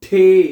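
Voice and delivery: ठे said loud with a strong aspirated ठ; aspirated the sound. Shown as a demonstration. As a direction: punch out ठे with a burst of air. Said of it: "ठे की आवाज निकाली आपने". (ज़ोर से ठे, हवा के साथ)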